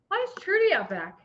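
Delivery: high-pitched retold bewilderment; puzzled. Woman spotting an animal out back, high and puzzled. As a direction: act baffled, pitched high. Retelling her puzzlement at the hog.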